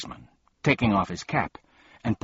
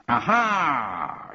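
triumphant aha; 7 semitones higher than usual. A narrator's reading. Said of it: "interrupted Officer Schrift".

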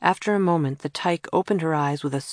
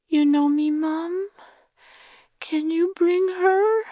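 put-on small child asking; 13 semitones higher, 3 dB louder, slower than usual.

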